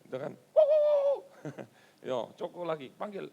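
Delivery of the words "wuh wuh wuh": high loud woof woof imitation; mock dog barking.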